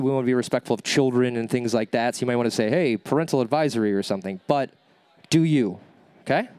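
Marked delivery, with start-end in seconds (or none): none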